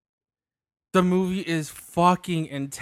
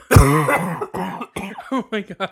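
raspy cough, then a slow rasped oh my God; smoker's rasp; 4 dB louder, much slower than usual. Retold or imitated. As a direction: hack it out like a heavy smoker. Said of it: "not my smoker's cough".

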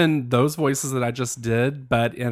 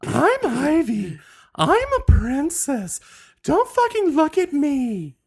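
high-pitched haughty pet, stretching the words; Ivy; 13 semitones higher than usual. Acted. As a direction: play a bratty little terrier diva. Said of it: "this is her voice".

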